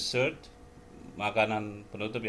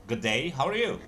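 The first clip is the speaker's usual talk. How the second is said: Aussie good day, how are you; Australian greeting; 3 semitones higher, 3 dB louder than usual. Shown as a demonstration. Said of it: "kalau orang Australia ngomongnya begitu".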